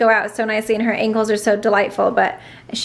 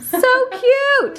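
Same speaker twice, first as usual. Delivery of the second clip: squealing; 14 semitones higher than usual. Real, not acted. Gushing over arm result.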